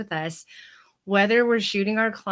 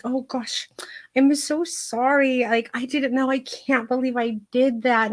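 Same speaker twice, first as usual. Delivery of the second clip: higher-pitched, mock-contrite gushing; gushing apology; 6 semitones higher than usual. Client apologizes to handsome vet dressing her down, gushing apology. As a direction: imitate yourself apologizing profusely.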